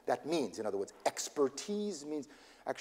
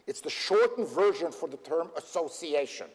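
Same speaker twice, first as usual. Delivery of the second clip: ordinary talk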